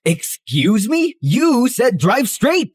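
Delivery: high, slow outraged protest; incredulous and stretched out; indignant disbelief. Acted. Told to block path.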